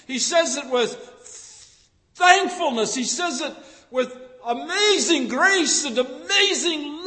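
rising from plain to a loud, high preaching cry; building sermon climax. Acted. Preacher piling up praise for God's love, building to a cry.

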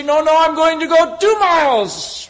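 a cheerful believer insisting on a second mile; eager offer. Acted. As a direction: gladly overrule him. Eager offer to walk further.

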